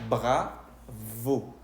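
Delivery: "bravo" with French R and biting V; French pronunciation. Shown as a demonstration. Teacher reading out the French word, French-sounding "bravo". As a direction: say "bravo" as French bra-VO, lip-bitten V. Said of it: "en este caso se leería".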